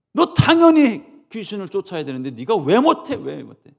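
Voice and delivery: loud, high angry scolding. Acted. Jesus scolding his disciples, loud and angry. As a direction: tear into them. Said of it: "엄청나게 혼내신 거예요".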